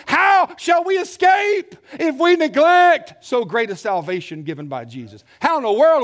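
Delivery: loud, ringing pulpit preaching; preaching at full force. Acted. Preacher rousing the congregation about the Lamb, full force. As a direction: drive each line home to the pews.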